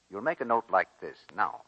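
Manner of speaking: ordinary talk, nothing marked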